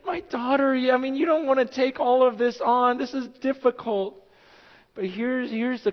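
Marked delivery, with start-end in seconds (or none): 0.1-4.2 higher, earnest questioning; probing gently but pitched up; pastor's warning. Retold or imitated. Pastor questions the groom, raised and earnest. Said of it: "I'm like".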